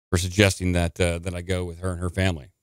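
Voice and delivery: ordinary talk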